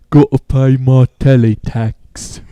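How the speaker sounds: put-on slow, lightly done Cockney accent; old-timey Cockney accent, done slowly. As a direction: drag out a mock Cockney accent. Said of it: "a nineteen hundreds Cockney accent".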